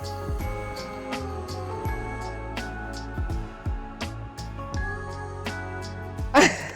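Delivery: breathless high giggle; laughing. Real, not acted. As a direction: burst out laughing in disbelief. Sudden heavy snow on camera.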